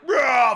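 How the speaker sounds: quick high imitated roar; tiger roar. Telling how the tiger got the man on the elephant, quick roar.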